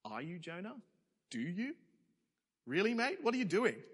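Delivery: mock disbelief. Mocking Jonah in disbelief.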